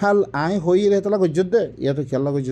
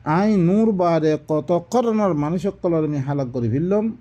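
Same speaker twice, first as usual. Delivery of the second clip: usual speaking voice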